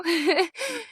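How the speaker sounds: high, light laugh at oneself; small high-pitched giggle; emotional laugh. Real, not acted. Laughs at own sudden emotion.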